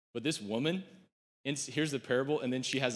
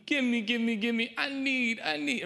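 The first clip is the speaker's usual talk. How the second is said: high, louder whining give me; whiny self-centered plea; 11 semitones higher, 4 dB louder than usual. Pretend. Preacher mocks a selfish prayer with a high greedy whine. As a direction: whine like a greedy child demanding more. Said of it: "it becomes about me".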